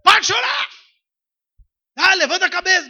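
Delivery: loud, high impatient command; mock scold. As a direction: bark stop crying. The scolder again.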